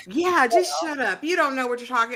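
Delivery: high, loud brush-off; mock dismissal. Panelist voicing Rowan running over Cortland, mock dismissive.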